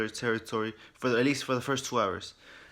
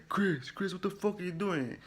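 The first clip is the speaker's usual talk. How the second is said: higher-pitched mock dismay; imitating a distraught question; retold bewildered plea; 7 semitones higher than usual. Acts Ethan confronting Chris.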